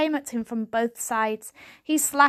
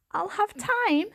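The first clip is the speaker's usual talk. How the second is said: Varjak's bewildered echo, pitched up high; confused question; 10 semitones higher than usual. A narrator's reading. Young cat echoing his grandfather, bewildered. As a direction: repeat it back in disbelief.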